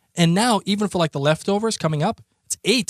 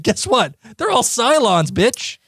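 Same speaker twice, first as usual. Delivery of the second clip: taunting; 7 semitones higher, slower than usual. Retold or imitated. Voicing the writers' taunt.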